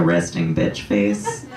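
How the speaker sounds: normal talking voice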